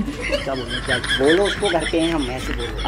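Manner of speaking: low, soft urgent aside; feed a friend the line under the breath; hushed prompting. Acted. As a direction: quietly coach an accomplice off the phone. Aside coaching friend what to say.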